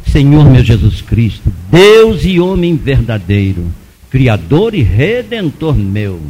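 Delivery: slow, solemn recited prayer; oração recitada. Retold or imitated. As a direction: recite the prayer low and deliberately. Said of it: "aí fui orar o credo". (reza solene, pausada e grave)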